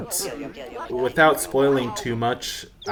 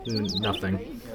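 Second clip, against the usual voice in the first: hushed trailing off; 5 semitones lower, 8 dB quieter than usual. Real, not acted. Catches himself mid-joke.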